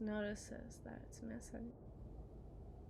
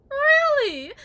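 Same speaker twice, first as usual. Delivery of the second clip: much higher and louder, cracking shriek; shrieking mock disbelief; 13 semitones higher, 19 dB louder than usual. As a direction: shriek it in hysterical mockery. Wife mocking husband.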